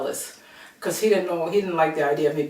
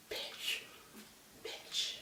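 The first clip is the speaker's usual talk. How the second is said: whispered, spaced-out insult; imitating muttered insult; 19 dB quieter than usual. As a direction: say it under your breath, mimicking her. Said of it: "She kept saying".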